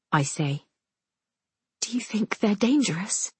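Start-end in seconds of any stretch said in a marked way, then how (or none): 1.8-3.3 put-on higher, hushed girl's voice; sister's frightened question. A narrator's reading. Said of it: "Tatiana asks finally".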